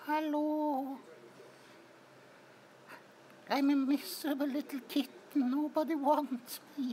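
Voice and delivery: high, fast pitiful kitten voice; mock pitiful kitten; voicing a sad kitten. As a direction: speak for the little cat, woe is me.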